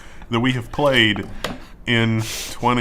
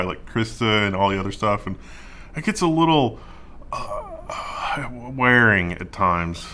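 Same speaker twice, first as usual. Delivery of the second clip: ordinary talk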